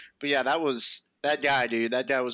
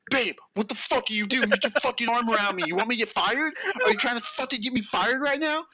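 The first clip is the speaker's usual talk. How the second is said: higher, harsh angry outburst; angry snap; 6 semitones higher than usual. Retold or imitated. Boyfriend in costume snapping angrily at his girlfriend. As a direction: scold her furiously under his breath.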